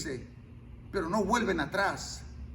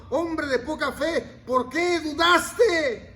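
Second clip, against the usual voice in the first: slow, higher, shouted reprimand; stern rebuke; 5 semitones higher, 5 dB louder, slower than usual. Retold or imitated. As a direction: scold the doubter hard. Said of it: "Lo reprendió el Señor". (reprensión severa, lenta y gritada)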